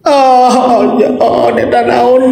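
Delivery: normal talking voice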